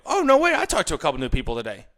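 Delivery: hijack her story with delight; excited interruption. Retold or imitated. Husband cutting off his wife's story, excitedly. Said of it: "Immediately, I go".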